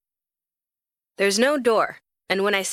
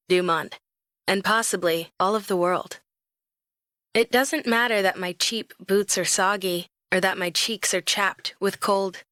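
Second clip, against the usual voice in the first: ordinary delivery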